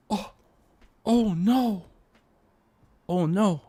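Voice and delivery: theatrical dismay at the forbidden duet; mock horror. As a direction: act scandalized.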